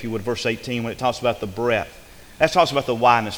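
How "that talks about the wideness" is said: building sermon, louder; rising preaching. Acted. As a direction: start pushing the preaching up.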